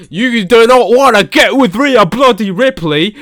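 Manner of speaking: trash-talk in a bad Australian accent; hammed-up Australian accent. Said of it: "such a bad impression of ... an Australian accent".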